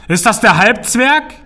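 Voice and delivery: high, slow, loud troll asking; a big troll calling a question up from the cellar; troll booming a curious question. Acted. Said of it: "erklang Parts fragende Stimme".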